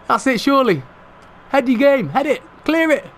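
high-pitched, anxious urging; urgent match shouts. Real, not acted. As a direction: call orders to the defenders in the dying seconds. Urging defenders in final seconds.

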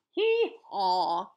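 loud imitated hee-haw; donkey bray. Donkey's bray in read-aloud story.

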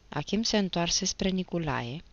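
plain reading voice

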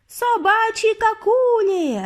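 high, drawn-out crowd shriek; frenzied witch screech; screaming. A narrator's reading. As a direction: yell it long and high in frenzy. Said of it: "репетували вони".

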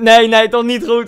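loud, slow, mock pained groan; hammed-up cramp complaint; retold pain. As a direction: moan as the cramp returns. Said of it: "En toen".

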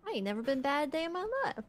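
fast, soft innocent protest; naive innocence. Acted. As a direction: play the little angel. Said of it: "that you do when you're bad".

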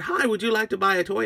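quick, high-pitched friendly offer; little seller's pitch. Acted. Agnes greeting a customer, high and quick.

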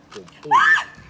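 very high, loud shrieking laugh. Real, not acted. Laughter shout at trick.

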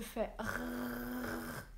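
rrrr rolled at the back of the throat, gargling; throaty French R. Shown as a demonstration. Teacher showing students the French R, gargled rrrr. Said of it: "imagine que tu mets l'eau dans la gorge".

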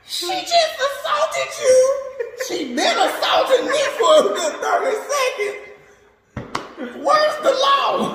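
put-on boy appealing to the cop for help; outraged disbelief. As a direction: plead for justice in disbelief. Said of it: "I'm like".

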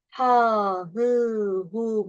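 "Ha, hı, hu" drawn out with broad Turkish vowels; long back vowels and plain h on "Ha, hı, hu"; wrong reading, thick "Ha, hı, hu". Shown as a demonstration. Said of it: "Yanlış telaffuz yapalım".

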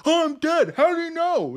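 high, loud mocking whine; high-pitched outraged gamer impression; mock indignation. Mimics indignant killed players.

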